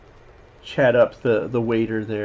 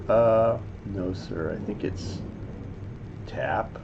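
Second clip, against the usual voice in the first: low, soft, hesitant reply; deferential waiter; 4 semitones lower, 7 dB quieter than usual. Acted. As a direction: lightly play an apologetic waiter, voice dropped. Acting the waiter's reply to customer.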